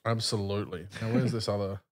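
normal talking voice

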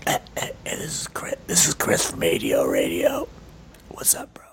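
soft unvoiced whisper; whispered mock intro. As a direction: whisper the show intro like a secret. Whispered impression of absent Chris.

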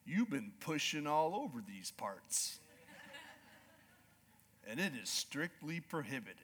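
low, slow rancher drawl; slow cowboy drawl. Retold or imitated. Ranch manager to hunter, a slow low drawl. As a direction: lay it out easy like an old cowboy. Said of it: "slow, calm drawl".

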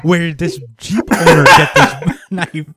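cracking up at the plot hole; laughing mock question. Real, not acted. Mocking the story's editing.

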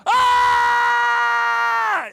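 a sudden shrill-pitched scream, loud and high; high piercing scream. Shown as a demonstration. Preacher imitating the skier's scream, loud and high. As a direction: scream like a lady hurtling down the ski hill. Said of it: "what she was actually saying was".

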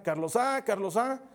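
calling a name loudly down the hall, high; retold calling out. Parents call to their son when the power cuts, loud calling. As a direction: call out anxiously in the dark.

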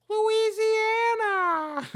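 very high, very slow, loud yell; high-pitched shouted Biden parody; Biden impression. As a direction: drag the name out in a high squeal. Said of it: "He's more high-pitched".

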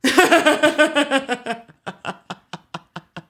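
long loud laughter. Real, not acted. Laughing at impression.

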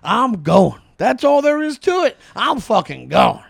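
loud, eager declaration; loud excited resolve; excited outburst. Acted. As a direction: burst out wanting to go. Jules excited in rehearsed scene.

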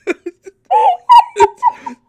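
shrieking laugh. Real, not acted. S1 cracks up.

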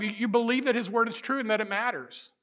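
ordinary delivery

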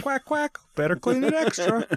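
high squeaky quacking duck. Acted. Voicing the duck toy.

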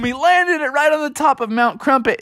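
ordinary delivery, nothing marked

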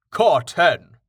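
firm warning call of a name; admonishing. A narrator's reading. Jameis rebukes Carten for calling Tallheart a deer, admonishing. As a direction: scold him gently with just his name. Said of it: "Jameis admonished".

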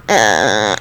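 very low creaky drone; soft, low sustained creak; vocal fry noise. Shown as a demonstration. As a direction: demonstrate a long croaky fry. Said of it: "don't actually have this noise".

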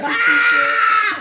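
excited shout. Real, not acted. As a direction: hype it up like a giveaway. Hyping free giveaway on radio.

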